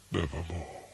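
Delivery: soft, sighing lament; sorrowful hush. A narrator's reading. Narrator mourning lost Lenore, soft and sighing. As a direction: let it fade into grief.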